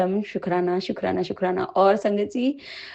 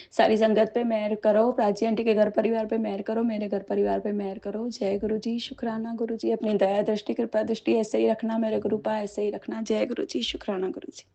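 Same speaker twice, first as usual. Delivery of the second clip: softer and softer devotional prayer; 6 dB quieter than usual. Real, not acted. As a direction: pray quietly, fading away. Closing prayer to guru.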